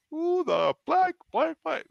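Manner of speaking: very high-pitched mock tirade; censored outburst. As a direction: re-enact blowing up, curses swapped for nonsense. Retelling own censored outburst at stranger.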